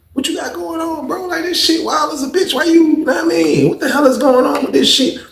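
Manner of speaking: high, incredulous scolding; exasperated. Retold or imitated. Narrator asking his cellmate what he was thinking, exasperated. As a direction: demand an explanation, disbelieving.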